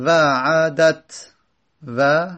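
وعدت drawn out slowly, sound by sound; slow syllable demo. Shown as a demonstration. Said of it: "حرکت داره".

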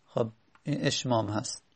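normal talking voice

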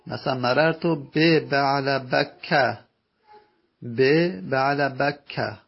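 slow "مررت به بعل بکه", fatha at the end; slow Arabic example. Shown as a demonstration. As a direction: read "به بعل بکه" slowly, ending in "bakka".

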